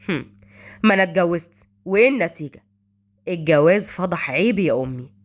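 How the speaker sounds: low-pitched male character, bitter; cool brooding son. A narrator's reading. Son tells mother his marriage failed, cool and bitter. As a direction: answer in a deep, measured male voice.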